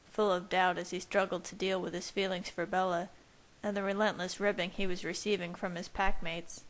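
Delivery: ordinary delivery, nothing marked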